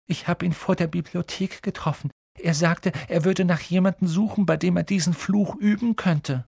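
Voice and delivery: put-on a scared boy telling what happened; trembling Neville. A narrator's reading. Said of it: "sagte Neville mit zitternder Stimme".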